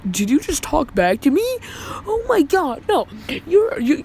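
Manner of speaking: high, rising disbelief spiking way up; mock outraged principal. Principal shocked that the boy talks back, outraged high voice. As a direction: act appalled at the backtalk.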